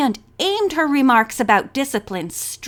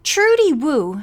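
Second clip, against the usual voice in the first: usual speaking voice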